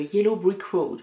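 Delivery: slow, practised English accent on the name. Shown as a demonstration. Knitter naming the mustard colourway to viewers, English accent. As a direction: show off a bit of English accent. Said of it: "je travaille mon accent anglais".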